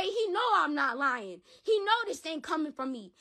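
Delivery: ordinary talk, nothing marked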